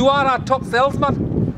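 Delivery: high, slow mock Geordie; Newcastle accent. Praising the top salesman in put-on Geordie. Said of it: "How's me Newcastle accent like?".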